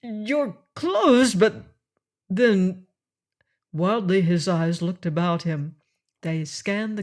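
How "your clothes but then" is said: aghast. A narrator's reading. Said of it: "still more aghast he added".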